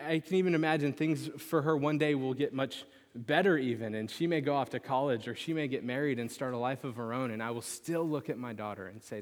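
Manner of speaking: ordinary talk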